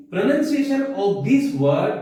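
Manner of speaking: very slow, word by word, like dictating a rule; slow, careful English dictation. Shown as a demonstration. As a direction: spell the rule out slowly for students. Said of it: "teacher reads English rule slowly".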